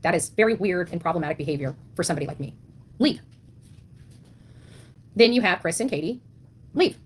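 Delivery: normal talking voice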